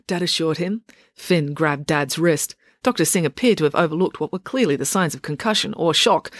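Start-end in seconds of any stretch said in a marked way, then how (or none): none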